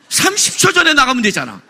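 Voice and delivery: loud, high mock complaint; exasperated outburst. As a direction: burst out in half-laughing exasperation. Exasperated complaint at people walking out.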